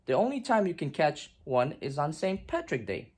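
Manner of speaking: plain reading voice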